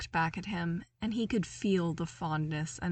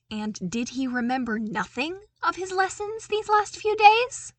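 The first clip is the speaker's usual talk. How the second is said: sharp, rising scold, much louder and higher at the end; scolding Vader; 9 semitones higher, 7 dB louder than usual. A narrator's reading. Vader scolding Luke about forgotten lessons, loud rising rebuke. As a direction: bark it like a stern master catching a slip.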